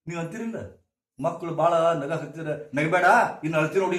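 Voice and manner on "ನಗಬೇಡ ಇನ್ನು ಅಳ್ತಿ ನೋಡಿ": scolding parent. Retold or imitated. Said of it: "ಅಂತ ಅಂತೀರಿ ನೀವು".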